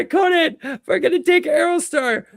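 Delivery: high, loud gushing writer voice; mock eager pitch. Writers gushing ideas at Conan, high and excited. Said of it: "Like, Conan, yeah".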